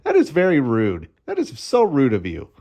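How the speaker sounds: mock indignation. Mock scolding his girlfriend.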